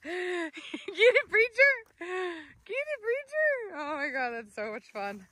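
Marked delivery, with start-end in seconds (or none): 0.0-1.7 high cheer egging the dog on; excited cheer. Real, not acted. 2.0-3.8 high, laugh-broken cheer. Real, not acted.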